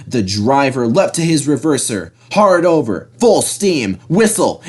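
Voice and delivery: usual speaking voice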